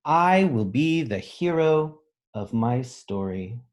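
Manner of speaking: slow, proud picture-book reading; slow storybook read. A narrator's reading. Teacher reading to children, slow and proud. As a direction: say it slowly like a promise.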